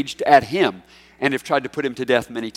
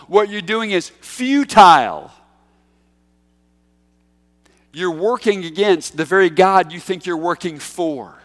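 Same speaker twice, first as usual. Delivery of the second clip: Jesus rebuking; 3 semitones higher, 6 dB louder than usual. Retold or imitated. Paraphrasing Jesus to Saul.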